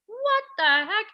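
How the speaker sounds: high, loud, slow bewildered cry; tearful disbelief. Retold or imitated. Confused at her own tears after the show, crying out. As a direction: wail it at yourself through tears. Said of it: "I was just sobbing".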